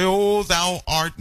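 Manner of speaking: high, slow, lofty churchy voice; mock King James prayer. Preacher mocking people praying in King James English, slow lofty mimicry. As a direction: lightly parody old-fashioned pious prayer talk. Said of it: "That's you trying to imitate the King James Version".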